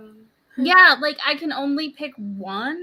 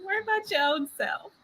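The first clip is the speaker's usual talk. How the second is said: quick, higher, laughing through the words; laughing repeat; 7 semitones higher, faster than usual. Real, not acted. Repeating the girl's sassy line, laughing.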